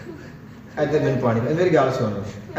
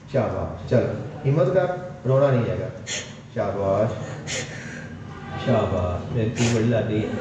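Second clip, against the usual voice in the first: soft lower reassurance; 8 semitones lower, 4 dB quieter than usual. Real, not acted. Healer soothes frightened daughter.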